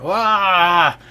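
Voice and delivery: high, loud wail of someone falling; acted cry, plummeting from a roof; falling scream. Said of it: "continuing to fall down".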